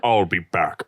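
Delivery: put-on very low, soft Austrian-accented rumble; Arnold Schwarzenegger impression. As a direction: drop it way down like Terminator. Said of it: "Way to drop it down".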